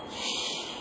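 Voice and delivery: unvoiced hush to silence her. [name] hushes the lovestruck old lady, unvoiced shush. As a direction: shush her sharply under the breath.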